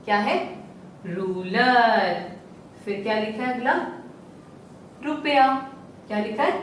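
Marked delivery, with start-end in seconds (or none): 1.1-2.3 रूलर with a long drawn-out रू. Shown as a demonstration. Teacher to class, stretching रूलर slowly. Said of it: "थोड़ा सा समय लेकर बोलेंगे".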